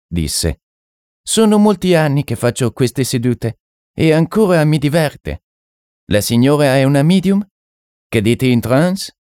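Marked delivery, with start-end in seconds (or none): 1.2-9.1 high-pitched, lilting French occultist; French medium. Acted. French medium questioning a lady at a séance, high-pitched and curious. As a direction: voice an eccentric foreign mystic, pitched up.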